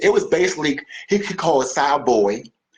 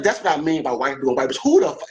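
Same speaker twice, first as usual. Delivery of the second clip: normal talking voice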